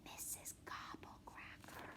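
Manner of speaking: breathless spooky whisper of the name. Acted. Brother teaching the scary name, in a spooky whisper. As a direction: hiss the name so she can't hear. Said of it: "you have to whisper when you say her name".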